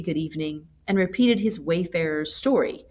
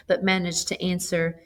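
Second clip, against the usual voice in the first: plain reading voice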